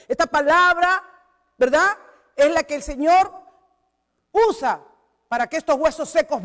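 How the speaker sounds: loud, rising preacher's exhortation; preaching at full force. Acted. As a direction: drive the church to faith with fire. Closing exhortation of the sermon. (predicación fervorosa y en voz alta)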